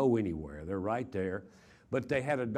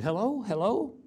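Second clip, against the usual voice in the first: sharply higher, worried calling into the phone; anxious hello; 11 semitones higher, 3 dB louder than usual. Retold or imitated. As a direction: call out, alarmed, not sure anyone's there. Phone call, woman screaming on line.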